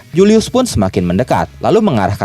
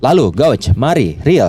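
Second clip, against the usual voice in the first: plain reading voice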